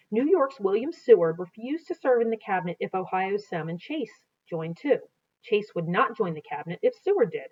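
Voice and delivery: reading aloud from a history book, steady and clear; storybook narration. Acted. A teacher reading a Lincoln history aloud to students, in narration voice.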